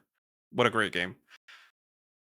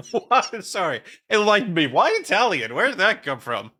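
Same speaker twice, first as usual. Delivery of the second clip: surprised laugh; 8 semitones higher, 7 dB louder than usual. Real, not acted. Amused disbelief at chat suggestion.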